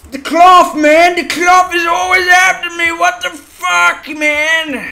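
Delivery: high, loud outburst; exasperated shout. Real, not acted. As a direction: yell at the clingy cloth. Cloth keeps sticking in game.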